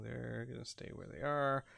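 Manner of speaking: normal talking voice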